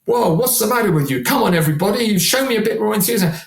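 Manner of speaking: high, pushy chiding of the crowd; hammed-up nagging for enthusiasm; mock scolding frontman. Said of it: "And you go".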